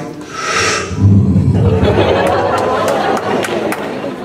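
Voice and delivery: long, noisy blown breath; slow-motion sound effect. Retold or imitated. Imitating homegirl running in slow motion, long blown breath. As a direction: stretch out a breathy whoosh. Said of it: "Homegirl was just like".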